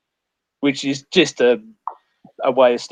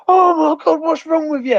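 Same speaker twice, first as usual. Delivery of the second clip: horrified squeal; 14 semitones higher, 4 dB louder than usual. Retold or imitated. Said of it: "And they went".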